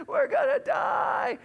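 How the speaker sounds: high, loud panicked interruption; imitated child's terrified shriek; screaming three-year-old. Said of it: "screaming at the top of her lungs".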